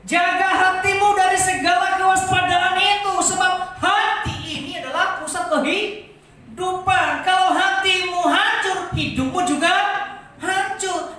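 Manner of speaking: loud, high, insistent sermon climax; full-force preaching. Acted. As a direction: hammer each hancur home to the congregation. (khotbah berapi-api dengan suara keras)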